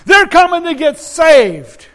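loud, high, drawn-out crowd cry; hollering. Retold or imitated. Interpreter reporting what the running mob is yelling, hollering. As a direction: holler each phrase as the exultant mob. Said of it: "They're hollering".

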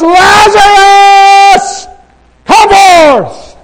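huge high shout, name stretched out long; thunderous drawn-out command; loud voice. Acted. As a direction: bellow it slowly to raise the dead. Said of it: "he cried out with a loud voice".

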